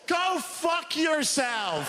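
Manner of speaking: high, slow drawn-out insult; mock snap. Real, not acted. Comic tells old people off, slow and high.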